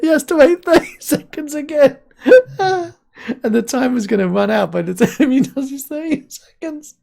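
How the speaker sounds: high, breaking with laughter; laughing hard. Real, not acted. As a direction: giggle through every word. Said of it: "I'm laughing so much".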